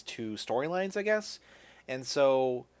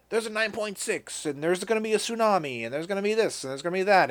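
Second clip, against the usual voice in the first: higher-pitched, rattling off a list of looming disasters; mock alarmed warning; 6 semitones higher than usual. Scientist warning of quake and tsunami, mock alarm. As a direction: imitate a scientist piling up predictions.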